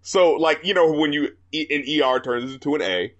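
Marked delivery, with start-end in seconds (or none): none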